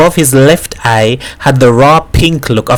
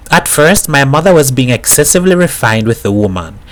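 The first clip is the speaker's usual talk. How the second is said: plain reading voice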